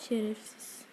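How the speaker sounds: softly muttered curse under breath; muttered insult. Real, not acted. Gamer cursing a boss under his breath.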